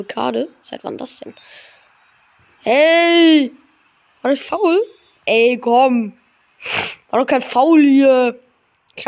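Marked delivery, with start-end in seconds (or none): 2.6-8.4 indignant. Real, not acted. Protesting a foul call.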